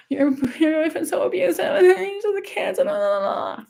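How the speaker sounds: high-pitched mocking wail, dissolving into blahs; mock crying. Mimicking someone who called about an abusive boyfriend, mock crying. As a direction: imitate a tearful caller, whiny and dismissive. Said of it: "You don't call crying to me".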